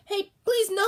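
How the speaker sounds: high, loud plea; calling after. Retold or imitated. Boy to departing father, loud plea. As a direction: call after him, begging. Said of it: "I called after him".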